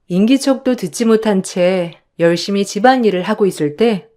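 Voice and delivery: usual speaking voice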